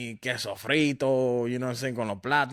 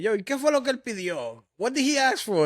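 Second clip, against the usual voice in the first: much higher, rising puzzled ask; baffled owner; 10 semitones higher than usual. Retold or imitated. Owner asking what the customer ordered, high baffled. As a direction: squeak it out in disbelief. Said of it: "The owner was like".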